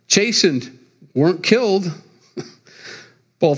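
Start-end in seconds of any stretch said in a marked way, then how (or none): none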